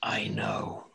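low, menacing whisper. Acted. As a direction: breathe it like a dark god. Deity whispers.

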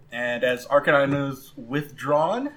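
ordinary talk, nothing marked

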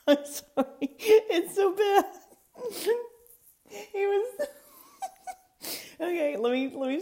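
high laughing, near tears; can't stop laughing. Real, not acted. Cracking up mid-story.

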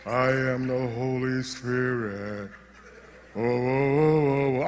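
low, slow ghostly moan; spooky spirit voice. Acted. Preacher imitates an imagined Holy Spirit to the congregation, low and spooky. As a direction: intone it deep and drawn-out like a movie phantom. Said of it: "talking like that".

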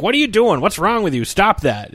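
put-on an exasperated father reining in his kid; mock dad scolding. Dad scolding Calvin, high exasperated then calmer.

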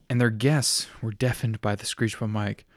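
ordinary delivery

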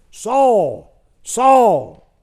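very high, very loud holler from heaven; booming divine cry of a name; God calling out. Acted. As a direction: call down like a voice from the sky. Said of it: "Just hollered at you".

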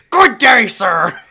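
loud, high "Good day, sir!" outburst; indignant shout. Retold or imitated. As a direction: bellow it like an offended gentleman. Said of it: "And Ben goes".

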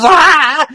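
high, loud retold yell of despair; mock yell. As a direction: belt out a sudden high cry. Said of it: "he's yelling".

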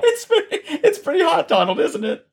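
laughing. Real, not acted. Joking along with duck voice.

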